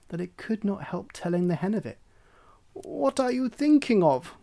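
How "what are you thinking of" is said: much higher, louder shocked hen; hen cries out. A narrator's reading. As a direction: cry out at the silly duckling. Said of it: "cried the hen".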